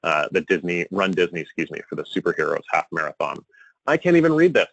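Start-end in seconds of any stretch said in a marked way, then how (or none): 3.9-4.7 high, louder exasperated cry; frustrated with the unreadable email, a high exasperated cry; exasperated outburst. Real, not acted. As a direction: throw up your hands at the tiny print. Frustrated speaker mocking tiny font.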